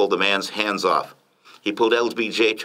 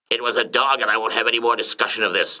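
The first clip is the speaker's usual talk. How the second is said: raised, firm dismissal; firm insistence; 4 semitones higher, faster than usual. A narrator's reading. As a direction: shut the discussion down as the boss. Ex-president shuts down the discussion.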